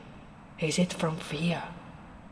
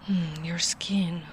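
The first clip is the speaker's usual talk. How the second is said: whispered concern; slower than usual. Acted. Inspecting the freezing traveler.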